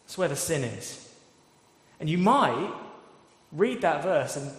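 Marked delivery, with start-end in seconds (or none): none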